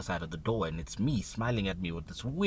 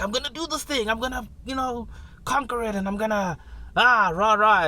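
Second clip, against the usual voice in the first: much higher, louder hyped cheer; pumped-up self; 13 semitones higher, 7 dB louder than usual. Retold or imitated. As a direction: psych yourself up like a fan. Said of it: "when you're like".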